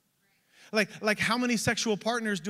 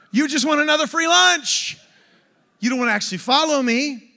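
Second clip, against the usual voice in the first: raised, higher challenging voice; confronting the crowd; 4 semitones higher, 6 dB louder than usual. Acted. Jesus calling out free-lunch followers, loud challenge. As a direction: call them out loudly.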